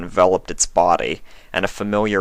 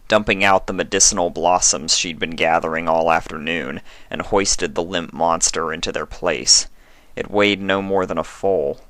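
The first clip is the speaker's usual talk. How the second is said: usual speaking voice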